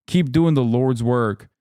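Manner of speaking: ordinary talk, nothing marked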